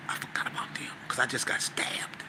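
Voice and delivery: hushed confiding whisper; whispered aside. Real, not acted. Aside about being stabbed.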